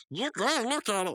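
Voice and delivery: high-pitched insistent mock order; Mordecai. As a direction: push a friend in a high voice. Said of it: "Mordecai saying".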